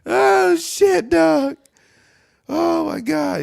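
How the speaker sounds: squeaky high voice while cracking up; high laughing exclaim. Real, not acted. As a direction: gasp it out between laughs.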